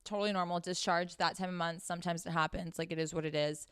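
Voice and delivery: low, easygoing it's-totally-normal voice; reassuring. Acted. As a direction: brush it off calmly, no big deal. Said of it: "just being reassuring and being like".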